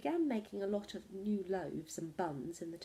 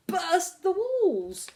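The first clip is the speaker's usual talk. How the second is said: dramatic burst; 9 semitones higher, 9 dB louder, much slower than usual. A narrator's reading. The cat bursts the house.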